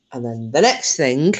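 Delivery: usual speaking voice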